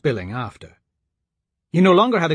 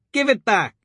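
put-on upset girl; 9 semitones higher than usual. A narrator's reading. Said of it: "Elise said".

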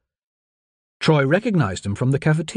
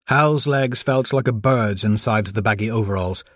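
ordinary delivery

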